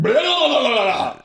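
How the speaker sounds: loud, high projectile-vomit noise; imitated vomiting. Storyteller imitating his brother throwing up, loud mock retching. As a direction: mimic an Exorcist-style retch, falling away at the end. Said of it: "rolled over and went".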